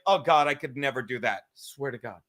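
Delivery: very low voice, trailing off softly; awed hunter. Retold or imitated. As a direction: drop deep in quiet, understated dread. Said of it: "And he's like".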